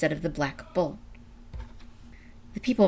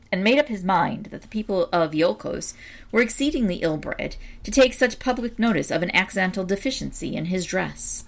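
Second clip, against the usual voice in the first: ordinary delivery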